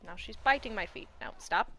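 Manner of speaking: normal talking voice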